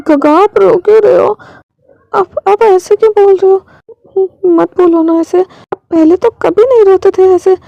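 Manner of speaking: put-on high-pitched, worried little girl voice; upset child. Shaozia asks her guardian why he is crying, upset.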